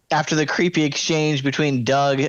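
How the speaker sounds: usual speaking voice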